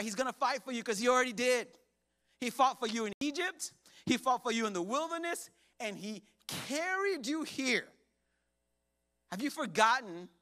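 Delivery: loud, high, driving sermon delivery; full-force preaching. Acted. Pastor preaching to the church, loud and driving. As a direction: preach it hard to the congregation.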